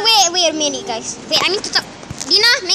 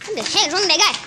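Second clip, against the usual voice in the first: usual speaking voice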